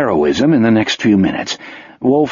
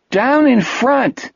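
a higher, slow annoyed heckle; audience heckle shout; 10 semitones higher, slower than usual. A narrator's reading. Moviegoer yelling at Wolf to sit down, annoyed. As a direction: shout at the standing man. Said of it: "someone shouted".

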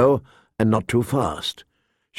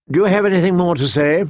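higher, quick question; lightly voiced king; 6 semitones higher, 3 dB louder, much faster than usual. King Joffrey asks Sansa for more, brisk questioning. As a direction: ask it briskly, pitched up.

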